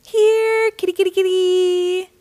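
high, loud sly coaxing call; cat burglar. Acted. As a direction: sweetly lure the kittens like a thief. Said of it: "called the cat burglar".

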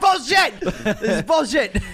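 loud high outraged yelling; outraged shout. Acted. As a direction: blow up in mock outrage. Outraged at Dune delay.

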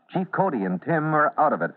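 normal talking voice

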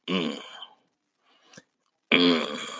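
short grunted "mm", feeling the music; appreciative "mm". Shown as a demonstration. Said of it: "music that makes you go".

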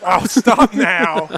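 slow words broken by laughter; laughing. Real, not acted. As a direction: laugh your way through it. Laughing at own hiccups.

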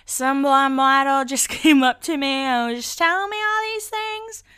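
put-on slurred drunk girl voice; drunk slurring. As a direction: slur it like you're tipsy. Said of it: "she's slurring".

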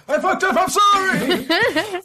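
high laughing apology. Real, not acted. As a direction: laugh it off sheepishly. Laughing apology after a flub.

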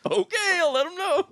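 high squeaky mock clerk voice; mocking phone clerk. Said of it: "best way to handle that phone call".

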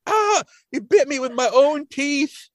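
high, drawn-out mock wail; Grandpa Simpson. Grandpa bitten by the turtle, high slow mock wail. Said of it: "Grandpa gets bitten".

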